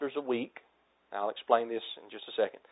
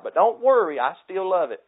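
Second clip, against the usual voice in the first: higher and louder, a hammed-up breezy don't worry; mock reassurance; 7 semitones higher, 7 dB louder than usual. Preacher voicing a careless Bible reader, hammed-up breezy reassurance. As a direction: brush off the worry with exaggerated cheer.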